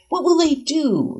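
high, drawn-out leading question; probing question. A narrator's reading. Sarah asks her student, probing.

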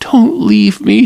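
high, loud tearful plea; weeping. Acted. Lila weeping, begging Farrell not to leave. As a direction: beg him through mild mock tears. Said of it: "she wept".

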